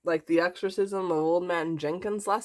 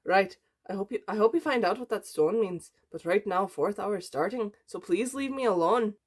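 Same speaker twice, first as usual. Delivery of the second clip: higher, lilting mock Irish accent; hammed-up Irish brogue; 5 semitones higher than usual. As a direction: play the teacher with a bad Irish accent.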